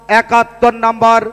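usual speaking voice

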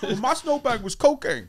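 high, goofy comedian confession; Tracy Morgan. Shown as a demonstration. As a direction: deliver it as an oddball comic. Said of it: "You had a Tracy Morgan".

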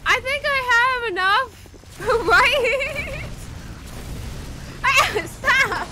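very high, loud panicked shrieks with laughs; loud, high panicked laughing screams; panicked screaming. Real, not acted. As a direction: scream through it while fumbling the button. Panicked under attack in game.

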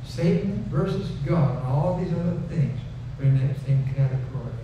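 usual speaking voice

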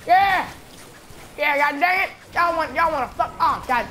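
high, loud taunting outburst; combative yell. Real, not acted. Gamer challenging the enemies he hates, combative yelling.

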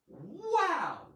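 high, swooping exclamation; exclaimed wow. Shown as a demonstration. Demonstrates interjection before exclamation point.

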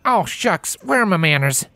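high, lilting country mare, firm; a country mare warning a city pony; Applejack's country voice. Acted.

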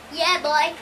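ordinary talk

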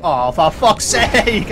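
high, slow, loud exasperated groan; drawn-out curse. Real, not acted. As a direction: stretch it out in disbelief.

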